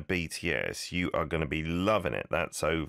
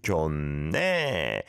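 네 long, swooping up and down; Busan intonation. Shown as a demonstration. Host modelling Busan dialect after a clip, swooping 네. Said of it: "the way Busanites would do it".

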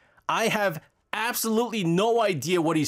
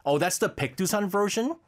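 usual speaking voice

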